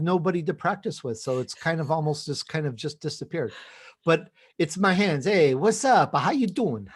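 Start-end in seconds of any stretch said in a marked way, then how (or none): 5.3-6.9 put-on a light Italian-New York accent, a bit louder. Closing the show for the host, in a light Italian-New York accent. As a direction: toss off a casual New York Italian greeting. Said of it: "Little New York in there too".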